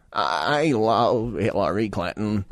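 slow, low, strained impression with drawn-out words; RFK. Host mimics the clip just played, slow mocking impression. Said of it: "is it mean to do the accent?".

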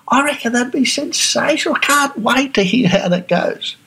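high, bubbly over-the-top enthusiasm; gushing American cheer. Retold or imitated. American friend hearing about the dog groomer plan, gushing excitedly. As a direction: gush like a thrilled friend cheering you on. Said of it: "the average typical American response".

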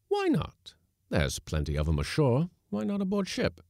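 heated questions, pitch jumping high; warm retort. A narrator's reading. Skipper defending his lady cook to the mate, warmly. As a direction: argue back with high-pitched challenges. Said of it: "inquired the skipper warmly".

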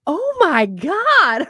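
slow, high, loud gasp of shock; mock shock. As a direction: draw out the shock of a stunned friend. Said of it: "looking at me in shock".

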